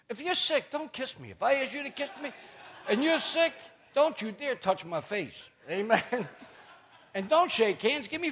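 high, emphatic mock scold. Wife scolding her sick husband not to kiss, high mock scold. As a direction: wag a finger at a sick husband.

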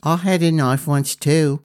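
a lad eyeing a sword; curious boy. A narrator's reading.

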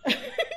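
a sudden, very high laugh; delighted high-pitched laugh; high burst of laughter. Real, not acted. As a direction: laugh out loud at the punchline.

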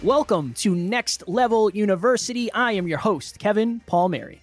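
upbeat announcer welcome; bright show intro read; podcast host intro. Acted.

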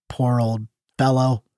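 a touch of put-on sorrow; mournful. A narrator's reading. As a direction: grieve gently over the pet, drawing out the words. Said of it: "triumph over grief".

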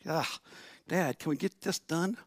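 mildly squirming kid, imitated; squeamish son. Squeamish son to dad during the talk, mildly squirming. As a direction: groan lightly, wanting it over. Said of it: "is a little squeamish".

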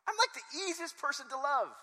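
high, loud insistence; hammed-up wounded outrage; indignant protest. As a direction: shout it in comic self-defence. Acts Bill's indignant protest.

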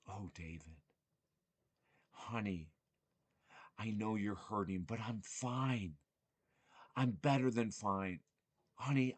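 hushed, loving reassurance from his mother; mother's gentle voice. Retold or imitated. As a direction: soothe him softly as his late mother. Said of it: "she used to always say this".

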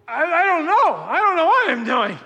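high, loud flustered panic; retold panic. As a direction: blurt it like a lost, scared new dad. Panicked new dad at birth.

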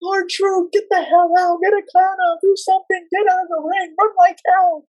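very high, frantic screamed warnings; shrill panicked ringside yelling; screaming. Retold or imitated. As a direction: yell at the wrestler to run. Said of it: "and I'm screaming".

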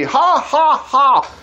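high hammed-up jeering laugh; mock scoffing laugh. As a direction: cackle like a TV audience scoffer. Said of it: "it's Saturday Night Live on T V".

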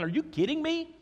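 high, disbelieving outburst; incredulous. Acted. Preacher reacting to the complaint, incredulous outburst. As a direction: sound truly unable to believe it. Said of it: "I'm thinking".

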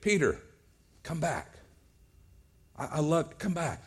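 soft, tender calling back with long pauses; hushed, loving appeal to a friend; gentle, forgiving plea. Acted. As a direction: plead quietly, without any condemning. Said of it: "He's not condemning. He's not beating him down".

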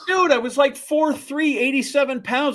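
high-pitched incredulous self-defense; exasperated protest. Real, not acted. Storyteller tells co-host he was too small, exasperated protest. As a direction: pitch way up, pleading your case. Comic protest to co-host's suggestion.

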